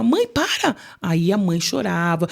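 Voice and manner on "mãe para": higher mock yell of mãe para; half-acted cry to stop mother; retold shout. Daughter yelling at her mother to stop, mock yell. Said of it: "saía aqueles gritos".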